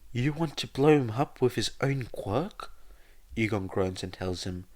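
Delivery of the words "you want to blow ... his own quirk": exclaimed. Acted. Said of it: "realizes what he says and exclaimed".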